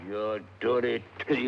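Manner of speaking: put-on lightly accented Lascar sailor; murderous Lascar. Disguised detective questioning tavern drinkers, lightly voiced Lascar. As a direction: speak in a guarded, put-on Lascar voice. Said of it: "in the character of a murderous Lascar".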